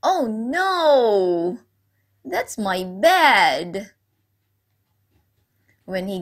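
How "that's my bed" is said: normal talking voice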